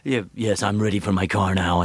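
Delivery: everyday request in a deep announcer register; trailer voice-over guy. Retold or imitated. Trailer guy asking for his car, deep announcer voice. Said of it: "You walk around, you go".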